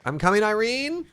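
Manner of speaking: loud high heroic call. Real, not acted. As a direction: shout it as you rush in. Calling out while rescuing.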